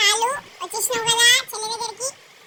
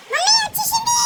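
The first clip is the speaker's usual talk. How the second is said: high, loud thrilled cry to mommy; excited shout; 11 semitones higher, 4 dB louder than usual. Real, not acted. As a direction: announce she is finally here. Aunt arrives, child shouts.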